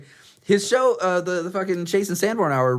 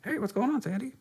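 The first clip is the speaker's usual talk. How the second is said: higher, softer greeting voice; ventriloquist voice; 8 semitones higher, 8 dB quieter than usual. Pretend. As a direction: play a cheerful puppet greeting. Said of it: "I can illustrate exactly how to do this".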